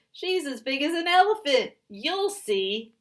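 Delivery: high, loud insistent claim; high loud defiant boast; shouting. Acted. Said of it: "shouted Lunchbox Louie".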